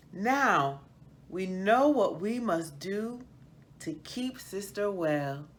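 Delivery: put-on lively storybook narration for children; story reading. A narrator's reading. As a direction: read it like a bedtime picture book to kids.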